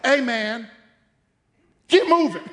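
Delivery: loud, high order with a laugh; God's impatient order. Retold or imitated. God telling the church to move, loud. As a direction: cut the prayer short. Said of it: "And God's like".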